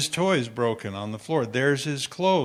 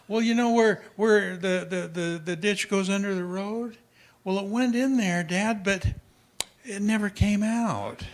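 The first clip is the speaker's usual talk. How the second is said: put-on higher, innocent kid voice explaining; little boy; 5 semitones higher than usual. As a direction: play the eight-year-old telling it plainly. Eight-year-old son voiced in story.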